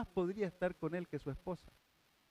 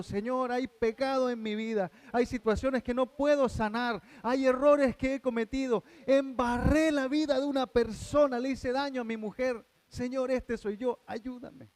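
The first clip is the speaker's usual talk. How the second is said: higher, louder, building plea that drops to a hush; fervent pleading prayer; 7 semitones higher, 4 dB louder than usual. Acted. Repentant sinner pleading with the Lord. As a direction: cry out to God then whisper for help.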